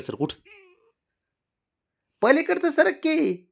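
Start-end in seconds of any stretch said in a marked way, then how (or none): 2.2-3.5 very high, slow sing-song coaxing to a pet. Real, not acted. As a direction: coax the dog sweetly in a high voice. Coaxing the dog to move. (कुत्र्याला लाडात उंच आवाजात विनवणी)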